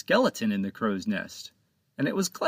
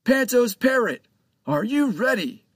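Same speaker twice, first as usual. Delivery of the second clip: hailing the parrot; 12 semitones higher, 5 dB louder than usual. Acted. Captain rallying the crew.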